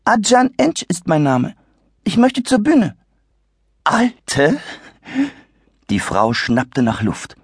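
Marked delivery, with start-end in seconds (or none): 0.0-3.0 put-on higher, formal young voice; courteous kid; polite boy. Boy introduces himself. 3.9-4.6 alte much higher with indignant rise; shocked shrill lady; outraged echo. Acted. Lady repeats the word.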